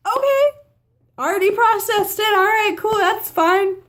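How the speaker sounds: high, chirpy, quick acceptance; breezy aunt. Retold or imitated. Aunt brushing off the news, high and breezy. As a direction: toss it off like it's no big deal. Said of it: "it was like".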